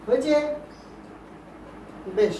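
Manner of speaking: normal talking voice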